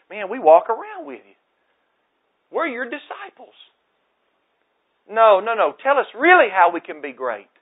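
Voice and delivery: excited protest. Retold or imitated.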